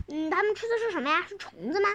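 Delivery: plain reading voice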